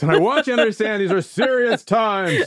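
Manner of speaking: deadly serious comic-book hero; superhero voice. Pretend. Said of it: "this is my superhero voice".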